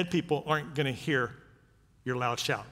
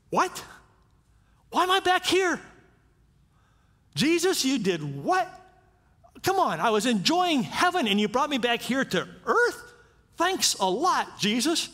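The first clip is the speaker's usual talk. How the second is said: indignant Lazarus; 7 semitones higher than usual. Acted. Lazarus complaining to Jesus after rising, high indignant whine. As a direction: whine at Jesus like someone dragged back from heaven. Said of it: "What would Lazarus be thinking here".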